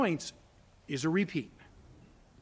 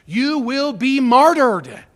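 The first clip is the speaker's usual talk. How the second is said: loud and slow, hammer out martyred; preacher thundering the word martyred; loud slow proclamation; 6 semitones higher, 10 dB louder, slower than usual. Acted. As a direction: declare it like a verdict. Climax of warning.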